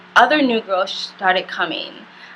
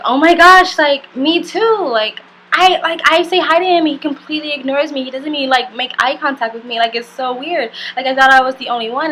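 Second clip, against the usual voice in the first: much higher and louder, gushing fast at the end; Irish girl gushing to a friend about being ignored too; excited gush; 5 semitones higher, 4 dB louder than usual. Retold or imitated. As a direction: gush like you just found a fellow victim. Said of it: "the Irish girl was like".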